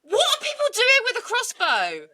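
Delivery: very high-pitched incredulous questioning; exasperated disbelief. Real, not acted. Host demanding why anyone owns a crossbow, incredulous. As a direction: squeal it out, baffled and outraged.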